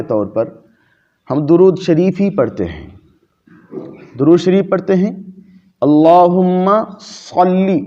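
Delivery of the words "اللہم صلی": اللہم صلی drawn out slowly, the ص of صلی thick and heavy; heavy saad in salli. Shown as a demonstration. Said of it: "وہ صاد سے ہے".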